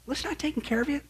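high, hushed voice of God; softly reassure, as if whispering; gentle whispering. Acted. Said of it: "he whispers to us".

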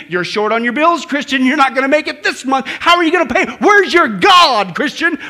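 loud jeer building in pitch; taunting devil. Acted. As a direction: badger them, stretching the final challenge. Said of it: "when the devil pipes in".

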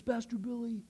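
high, lightly voiced tearful woman; understated post-cry address; composing herself after tears. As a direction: imitate her, a touch fragile. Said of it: "she finally composed herself".

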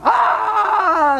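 sharp frightened shout; a high startled yelp of fear; terrified cry. Acted. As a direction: cry out in terror as you flee. Daniel's men flee in terror.

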